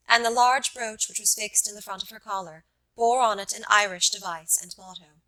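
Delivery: ordinary delivery, nothing marked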